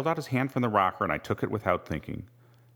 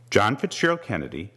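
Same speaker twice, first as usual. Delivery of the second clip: usual speaking voice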